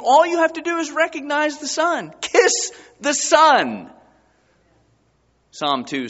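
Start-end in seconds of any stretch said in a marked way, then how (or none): 0.0-3.9 preaching. Acted. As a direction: drive it home to the pews. Preaching at full force.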